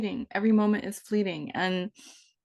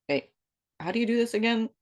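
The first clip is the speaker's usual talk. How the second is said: normal talking voice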